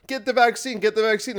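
a hint of a pushy scold; lightly mocked vaccine pusher.

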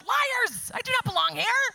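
high, loud, mock-furious retort; mock indignation. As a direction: play it as over-the-top offended. Mock outrage at a hashtag.

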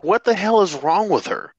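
high, indignant mom voice; outraged mother reaction. Retold or imitated.

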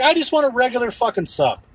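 high, emphatic exasperation; exasperated outburst. Real, not acted. Complaining about gourmet sub shops, high exasperated outburst. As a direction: burst out in frustration. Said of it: "exasperated pizza rant".

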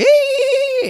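high mock child squeal; kid's squeal. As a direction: imitate a child's shriek at play. Kids screaming in play, retold.

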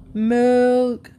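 loud crying call. Acted. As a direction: cry it out hungry. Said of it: "cried Heather".

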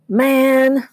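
loud, high disgusted trucker; a gruff man waving off a stink. A narrator's reading. Trucker enters the hot trailer, loudly disgusted. Trucker complains about the smell.

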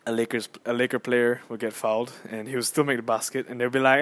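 normal talking voice

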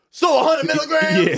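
high, sassy mock shout; sassy Kevin voice. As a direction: mimic him high and incredulous. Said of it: "He said it exactly like that".

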